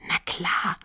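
hushed whispered reply; whispering back. A narrator's reading. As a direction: whisper it back to your friend. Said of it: "flüsterte Fred zurück". (zurückgeflüstert)

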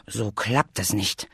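low, groaned complaint; groaning. A narrator's reading. Bear finds his hopping fails, groaning. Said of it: "stöhnte er".

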